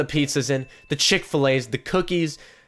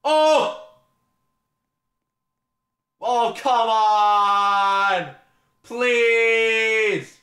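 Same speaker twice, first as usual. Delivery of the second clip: high, drawn-out pleading; frustrated yell; 7 semitones higher, much slower than usual. Real, not acted. Unboxing goes badly.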